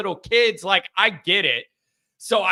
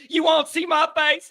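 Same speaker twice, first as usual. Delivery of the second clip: squeaky teasing reply, pitched way up; high mock voice; 10 semitones higher than usual. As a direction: play coy and refuse in a high put-on voice. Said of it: "repeats the bit".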